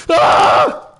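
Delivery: sudden high unvoiced shriek; startled scream. Real, not acted. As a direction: scream in real fright. Startled by the monster.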